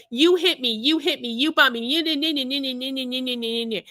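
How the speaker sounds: mocking whiny accusation trailing into babble; rapid mock tirade; mock screaming. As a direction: imitate a drunk accuser, running into nah-nah gibberish. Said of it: "started screaming at me".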